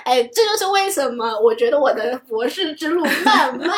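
embarrassed high laughter between words; nervous laugh. Real, not acted. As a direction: laugh awkwardly, caught out. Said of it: "好尴尬。".

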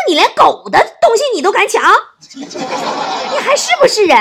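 high, loud outraged shout; hammed-up husband losing his temper; worked up. Husband to wife who snatched the peach back, worked up. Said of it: "老王当时就急了".

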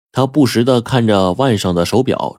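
usual speaking voice

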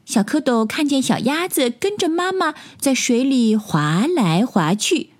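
ordinary delivery

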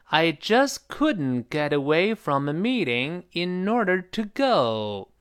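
slow, drawn-out practice sentence; slow model reading. Shown as a demonstration. Teacher repeating the sentence for students, slowly. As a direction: take it slowly, word by word. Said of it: "好，再来一遍".

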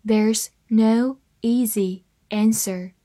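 slow, spaced-out model sentence; slow reading. Shown as a demonstration. English teacher models the sentence for learners, reading slowly. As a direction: read slowly, one word at a time. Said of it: "慢慢来读".

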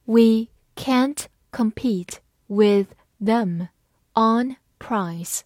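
slow, word by word; measured teacher's model reading. Shown as a demonstration. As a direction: read slowly with pauses between words. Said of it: "慢慢来读".

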